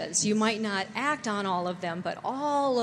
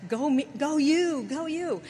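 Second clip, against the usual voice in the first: higher, louder cheer; cheerleader cheer; 6 semitones higher, 3 dB louder than usual. Acted. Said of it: "relentless, hardcore cheerleader".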